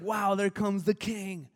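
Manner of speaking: mock awe.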